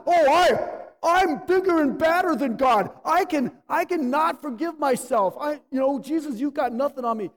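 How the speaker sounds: loud, high, sneering mock-arrogance; mocking boast. Pastor mocking believers who won't forgive themselves, loud sneering parody. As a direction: ham up a puffed-up sinner defying God.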